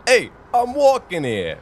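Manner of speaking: loud, high New York yell; New Yorker. Retold or imitated. Host voicing Eddie Brock on the street, loud New York accent. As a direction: bark it like an annoyed New Yorker. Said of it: "you know how New Yorkers do it".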